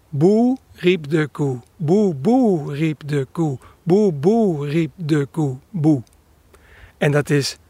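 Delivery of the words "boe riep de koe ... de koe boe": slow coo starting on a single falling "Boe"; imitated real dove song. Shown as a demonstration. Birder showing how the dove really sings, slow cooed chant. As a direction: chant it in drawn-out coos, ending on a lone "Boe". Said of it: "hij zingt eigenlijk".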